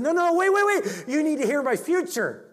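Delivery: very high, loud "wait, wait"; pleading call. Retold or imitated. Ironside calling after the leaving woman, urgently.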